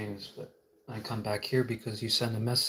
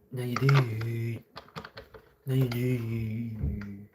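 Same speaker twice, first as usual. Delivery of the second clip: slow drawn out; much slower than usual. Acted. Slow stretched reveal.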